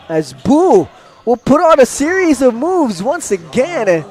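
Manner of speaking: high, loud, swooping call; excited play-by-play. Real, not acted. Commentator calls John Boo's series of moves, excited. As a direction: lift the voice as the move unfolds.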